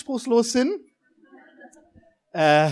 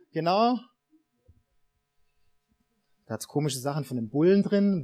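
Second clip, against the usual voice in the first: ordinary talk, nothing marked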